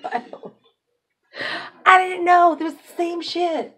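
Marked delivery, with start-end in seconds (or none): 1.8-3.8 loud, high astonished cry; dawning shock; surprised realization. Real, not acted. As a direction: blurt it out in shock. Sudden realization.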